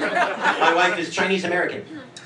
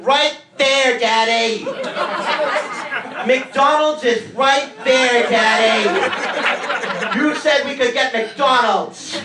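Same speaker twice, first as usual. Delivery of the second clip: put-on higher, louder whiny kid; son's voice; 4 semitones higher, 3 dB louder, slower than usual. Son begging dad for McDonald's, whiny kid voice. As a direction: beg like a child in the car. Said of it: "my son's voice going".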